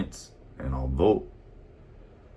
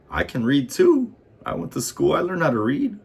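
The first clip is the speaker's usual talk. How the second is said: higher, louder indignant protest; indignant rant; 12 semitones higher, 6 dB louder than usual. Real, not acted. Ranting at the nerds, higher and louder. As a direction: push it up, offended and loud. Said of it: "indignant at the nerds".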